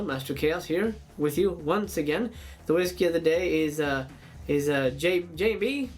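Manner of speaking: ordinary delivery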